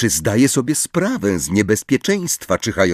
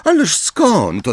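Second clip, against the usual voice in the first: high, slow biting retort; scathing; 11 semitones higher, slower than usual. A narrator's reading. Hermione answers Umbridge's question, scathing. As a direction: draw it out with venom. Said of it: "odpowiedziała Hermiona zjadliwym tonem".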